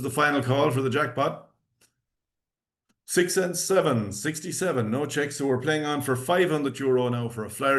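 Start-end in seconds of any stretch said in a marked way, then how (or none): 3.1-4.9 bingo caller. Acted. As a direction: call each number out clearly. Calling bingo numbers.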